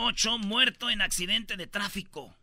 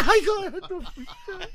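high, loud fake-furious curse; speaker's own reaction to the prank, in a high mock angry curse; mock outraged outburst; 11 semitones higher, 6 dB louder than usual. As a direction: spit it out, overdone.

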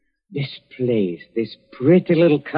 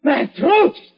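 high, strained, half-shouted terror; choking out each word in panic; rasping strained panic; 12 semitones higher than usual. Acted. Poisoned man panics at his killer.

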